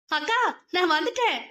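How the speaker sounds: excited calling. Acted. Girl runs in calling.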